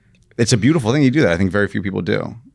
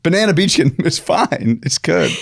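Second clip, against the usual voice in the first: soothing; 9 semitones higher than usual. Retold or imitated. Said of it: "And I'm like".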